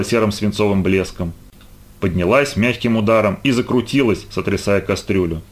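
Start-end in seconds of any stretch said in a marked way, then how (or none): none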